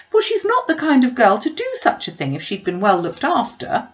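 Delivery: high, loud, aggrieved wail; Mrs Bennet lamenting. Acted. Mother complaining to her brother, loud and tearful. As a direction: pour out the complaints, near hysterical. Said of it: "with tears and lamentations of regret".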